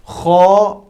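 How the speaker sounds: खौ with round lips and a heavy, thick sound; heavy rounded kha. Shown as a demonstration. Quran tutor demonstrates heavy खौ for students, lips rounded. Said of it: "खौ mouth circular मोटा पढ़ना है".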